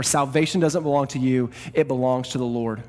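ordinary talk, nothing marked

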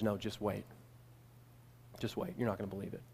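friend's soft hushed urging. Retold or imitated. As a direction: lean in and hush him, wait for it. Friend urging him quietly.

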